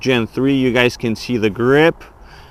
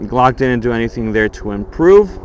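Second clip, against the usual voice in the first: ordinary delivery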